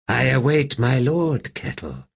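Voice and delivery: eerie, amused undead voice; an old ghost savouring its own tale; wraith Wither. A narrator's reading. Wraith to Kettle, amused and eerie.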